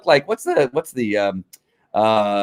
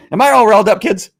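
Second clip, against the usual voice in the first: higher, louder, still heated from the rant; riled up; 5 semitones higher, 4 dB louder than usual. Real, not acted. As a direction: catch yourself mid-rant and laugh it off. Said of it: "I'm all riled up".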